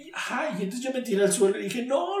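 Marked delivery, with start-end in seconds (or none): 1.8-2.2 high, loud reenacted cry of refusal; acted-out outcry; retold protest. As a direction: burst out in refusal, pitched way up. Retells his refusal to God.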